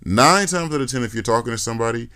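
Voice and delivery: ordinary delivery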